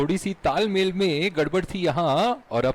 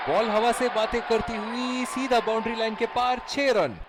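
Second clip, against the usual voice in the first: excited cricket commentary; 6 semitones higher than usual. Acted. Six called in live commentary.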